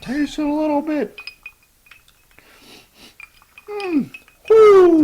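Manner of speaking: very high falsetto, then a loud whoop; squeaky hyper voice tasting whiskey; Crackhead John voice. Acted. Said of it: "Crackhead John impersonation".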